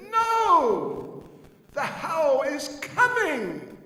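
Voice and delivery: put-on high, loud, slow child's cry; a small boy insisting the monster is coming; little Krishna shouting to his mother; insistent little Krishna.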